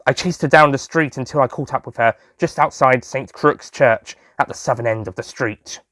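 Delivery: ordinary delivery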